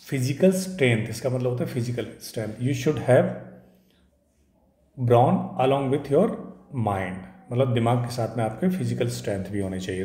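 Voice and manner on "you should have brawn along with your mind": slow example. Shown as a demonstration. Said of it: "example sentence".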